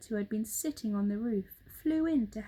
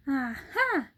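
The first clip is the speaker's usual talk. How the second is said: a touch louder gleeful aha; triumphant aha; 4 semitones higher, 5 dB louder than usual. Acted. Mugglewump springing his plan, gleeful. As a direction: pounce on the idea. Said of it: "cried Mugglewump".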